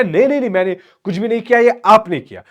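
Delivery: high, loud, fast protest; indignant denial. Acted. Daughter blaming father for everything, indignant. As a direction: fire the blame straight back, high. Daughter denies blame in quarrel.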